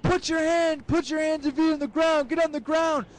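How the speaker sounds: high-pitched, urgent mock police commands; cop shouting orders. Cop arresting a rock thrower, frantic mock command. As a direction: imitate a cop yelling at a suspect.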